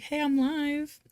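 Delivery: chirpy quoted announcement to followers; mock announcement. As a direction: announce it brightly, half joking.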